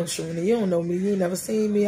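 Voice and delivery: usual speaking voice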